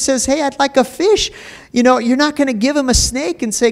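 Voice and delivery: high, loud fervent preaching; preaching at full force. Acted. Preacher urging the fathers in the congregation, fervent. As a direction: drive it home with rising fire.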